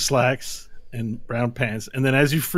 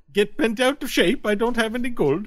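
accidentally Swedish-sounding leprechaun; 10 semitones higher than usual. Acted. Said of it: "He's Swedish all of a sudden".

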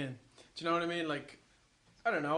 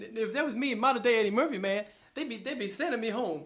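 higher-pitched, animated celebrity impression; Eddie Murphy; 5 semitones higher, 4 dB louder than usual. Comic playing a modern-day star in an interview, animated impression. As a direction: do a lively, chattering comedian voice. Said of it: "Can Eddie Murphy do an impression".

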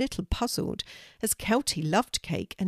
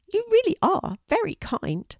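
much higher little bird voice; grateful pigeon; 5 dB louder than usual. Acted. Pigeon thanks Kelty for sharing cake, high grateful bird voice. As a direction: squeak it out like a small grateful pigeon.

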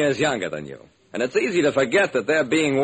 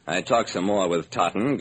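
ordinary delivery

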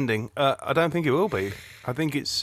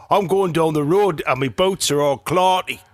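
loud, higher-pitched mock Geordie; Geordie accent; 6 semitones higher, 4 dB louder, slower than usual. Shown as a demonstration. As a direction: ham up a broad Geordie accent. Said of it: "your Geordie accent was terrible".

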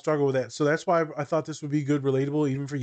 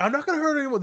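high defensive client; 13 semitones higher, faster than usual. Retold or imitated. Client backtracking.

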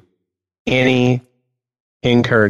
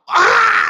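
high yelped scream; hammed-up scream; mock kid scream. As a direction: let out a silly high shriek. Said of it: "And I'm like".

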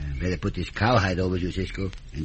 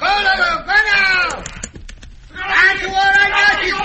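high, loud yells to the horse and partner; yelling; 9 dB louder than usual. Acted. Pancho spurring his horse to escape, high loud yell. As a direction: holler over the gunfire while riding off. Fleeing under fire, shouting.

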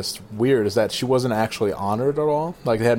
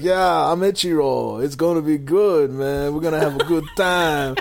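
Ichiro; 5 semitones higher, 3 dB louder than usual. Retold or imitated. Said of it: "Did he just join the Latin Kings?".

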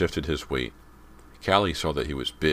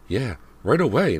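higher, louder, anxious repeat; nervous echo; 8 semitones higher, 6 dB louder than usual. A narrator's reading. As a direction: echo it nervously, forcing cheer. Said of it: "Missus Fraser echoed, biting her lower lip".

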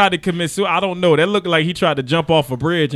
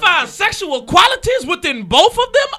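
shrieking high and loud with a laugh; mock horrified shout; 11 semitones higher, 6 dB louder than usual. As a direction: scream it in fake horror at himself. Mock horror at own question.

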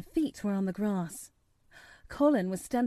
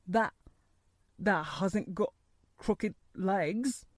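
put-on halting, hoarse old man; quavering, hoarse; slower than usual. A narrator's reading. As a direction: quaver it out in broken pieces. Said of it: "quavered Ben, more hoarsely yet".

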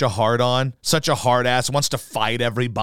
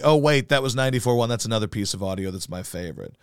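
ordinary delivery

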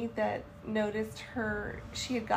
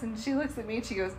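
usual speaking voice

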